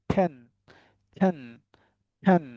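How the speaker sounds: টেন starting on aspirated ঠ; right pronunciation. Shown as a demonstration. Teacher drilling the class, টেন as ঠেন. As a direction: release a burst of breath in টেন. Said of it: "টেন না বলে এটাকে আমরা কি বলবো?".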